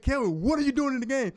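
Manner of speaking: high-pitched retold alarm at a teammate; retold freaking out. Linebacker to freshman sub on the field, retold freak-out. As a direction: act out freaking out, pitch jumping way up. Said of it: "I'm freaking out".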